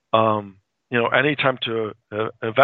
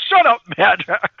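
raised, higher mock yell; acted-out telling-off, pitched up; retold exasperated rebuke; 8 semitones higher, 4 dB louder than usual. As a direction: snap it as a mock scolding.

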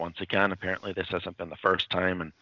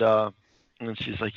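ordinary talk

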